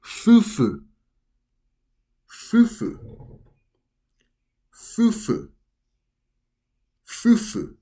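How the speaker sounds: fufu repeated slowly for learners; Japanese pronunciation. Shown as a demonstration. Teacher drilling the word for married couple, clear demo. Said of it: "this word is pronounced as".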